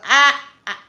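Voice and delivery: normal talking voice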